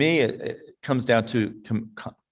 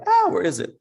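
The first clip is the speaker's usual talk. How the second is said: sudden search; 8 semitones higher, 3 dB louder than usual. Real, not acted. Searching for her notebook.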